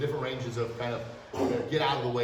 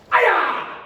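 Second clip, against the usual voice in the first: piercing high shouted hi-ya; full-force martial arts yell; lion voice kiai; 12 dB louder than usual. Shown as a demonstration. Instructor showing kids his lion voice, full kiai. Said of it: "That's how I practice".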